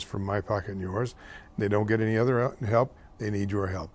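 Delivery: normal talking voice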